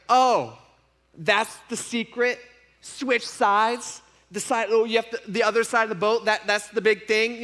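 mock them as if it's a silly secret; mock disbelief.

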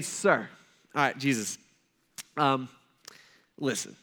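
low, hesitant, careful politeness; trying to be polite. Acted. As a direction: let someone down easy, pausing between words. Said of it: "He's trying to be polite".

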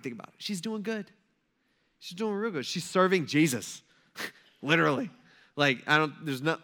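normal talking voice

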